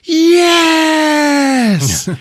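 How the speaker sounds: imitating a high, drawn-out shout of joy; triumphant cheer. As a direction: whoop it like a fan whose champion just appeared. Thor celebrates on seeing Hulk.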